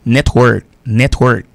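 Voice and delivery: Network stressed on net, falling; model network. Shown as a demonstration. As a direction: say "Network" clearly, first syllable strong. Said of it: "comme dans network".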